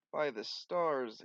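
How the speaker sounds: ordinary talk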